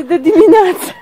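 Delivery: loud, high teasing question breaking into laughter; laughing. Real, not acted. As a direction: tease the noisy rooster and crack up. Teasing the crowing rooster, laughs.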